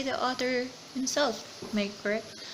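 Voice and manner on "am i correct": ordinary talk, nothing marked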